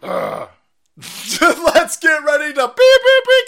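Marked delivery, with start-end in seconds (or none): 1.4-3.5 high, loud announcer bellow; mock ring announcer. Launching the stream like a ring announcer, bellowing. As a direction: boom it out like a boxing announcer.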